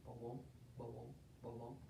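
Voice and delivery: very low, soft tortoise steps; low, quiet plodding sound. Acted. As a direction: murmur heavy little footfalls.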